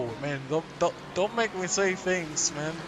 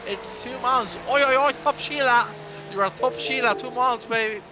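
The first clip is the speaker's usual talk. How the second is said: hammed-up Aussie, higher and louder; mock Australian accent; 4 semitones higher, 4 dB louder than usual. Thanking a subscriber on stream, mock Australian accent. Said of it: "That's Australian".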